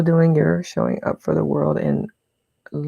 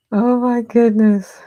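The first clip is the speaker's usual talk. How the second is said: amused exclamation; 8 semitones higher, 8 dB louder, slower than usual. Real, not acted. Laughing at a friend's comment.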